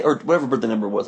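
ordinary talk

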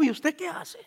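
"¿y usted qué hace?" asked softly and puzzled, voice dropping; hushed amazed question. Retold or imitated. Quoting a stunned man, a soft amazed question. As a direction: murmur it in disbelief. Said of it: "me dijo".

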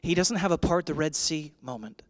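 normal talking voice

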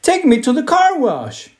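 eager demand. A narrator's reading. Eager child begs for car wash.

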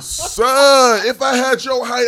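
high, loud, incredulous outburst; imitating a fired-up stranger; over-invested stranger. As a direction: exclaim it at full volume. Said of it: "he was so invested".

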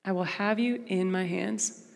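soft, slow promise; a hushed tender promise; quiet reassurance. Retold or imitated. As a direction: hold them gently with the words. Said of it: "Sounds a lot like".

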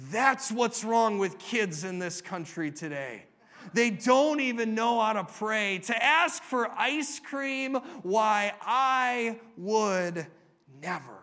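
hammed-up indignant grumbler, loud and drawn out toward the end; mock crabby disapproval; crabby woman. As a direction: scold like an affronted busybody, building, then drop on the last word. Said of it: "one woman who was very crabby".

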